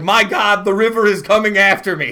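loud, high cry of alarm at the rising river; panicked shout. Pretend. First person to see a flood, crying out in terror. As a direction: yell it like the water is chasing you. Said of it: "You're like".

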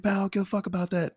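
mock slurred drunk retort; drunk guy voice. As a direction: toss it off quick and snotty like the drunk dude. Retelling the drunk guy's retort.

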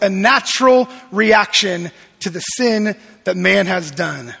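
usual speaking voice

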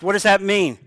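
ordinary talk, nothing marked

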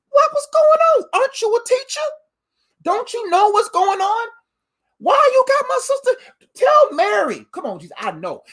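high, pleading complaint to Jesus; whiny Martha. Acted. Martha begging Jesus to make Mary help, high and whiny. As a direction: nag the teacher to send her sister.

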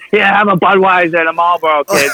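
sling the R's like a New England local; R-dropping New England accent. Retold or imitated. Local welcoming back a friend, in a New England accent. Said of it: "You're slinging your R's".

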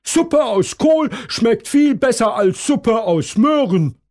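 loud high rabbit row; shouting back. A narrator's reading. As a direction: yell it across the fence, high. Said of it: "schreit Hasen Tom".

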